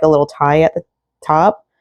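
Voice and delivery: ordinary talk, nothing marked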